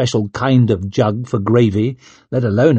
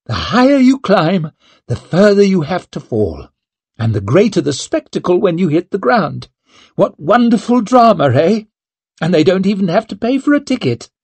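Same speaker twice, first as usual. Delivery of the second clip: bitter mockery; 7 semitones higher than usual. A narrator's reading. Bitter mockery of her downfall.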